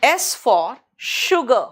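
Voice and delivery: sugar drawn out, S said as sh; slow teacher example; very slow demo. Shown as a demonstration. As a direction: slowly stretch S for sugar so the sh shows. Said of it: "अगर मैं कहूँ".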